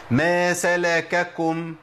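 slow chant of ما سلككم, ka then kum; drawn-out model recitation, two separate kafs in سلككم; both kafs voweled. Shown as a demonstration. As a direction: recite ما سلككم as salakakum, each kaf clear. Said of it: "عندي كاف مفتوحة وكاف مضموم".

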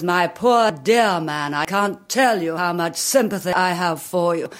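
measured, condescending show of sympathy; Margaret Thatcher. Acted. Imitating the leader addressing a beggar, manufactured sympathy. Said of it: "manufactured voices, you know, like Missus Thatcher".

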